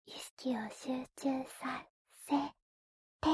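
breathy whisper into the ear. Acted. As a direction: whisper it playfully, close up.

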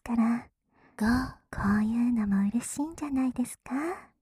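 whispering. Acted. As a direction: tease in a hushed whisper. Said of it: "ささやいてる反対のお耳から".

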